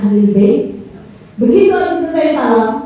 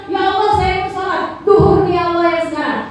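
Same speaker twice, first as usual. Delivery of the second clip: lightly sung mock prayer intention; 6 semitones higher than usual. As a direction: parody a careless worshipper's intention.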